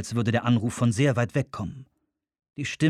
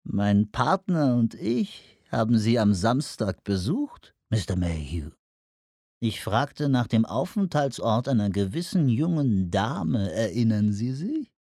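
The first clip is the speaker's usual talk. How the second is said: fuchshafte, ölige Anruferstimme; ölig, fies. Acted. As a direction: sprich ölig und mit gedehnten Worten. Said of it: "Der Tonfall war ölig, fies, fuchshaft". (öliger, fuchshafter Tonfall)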